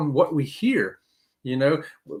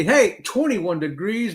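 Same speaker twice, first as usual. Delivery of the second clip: normal talking voice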